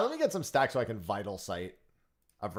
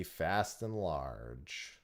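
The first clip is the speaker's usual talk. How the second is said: slow drawn-out; 5 semitones lower, 5 dB quieter, much slower than usual. Pretend. Repeats fish name slowly.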